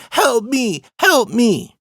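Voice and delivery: high-pitched mock cry for help; put-on high kid shout; imitated shouting child. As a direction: mimic a small kid calling out in distress. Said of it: "he starts shouting".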